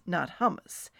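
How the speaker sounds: usual speaking voice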